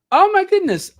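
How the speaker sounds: high, loud "Oh my goodness"; light up in happy surprise; thrilled exclamation at the gifts; surprised delight. Real, not acted. Sees new Buy Me a Coffee gifts.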